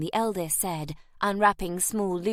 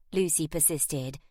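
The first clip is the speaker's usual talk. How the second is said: plain reading voice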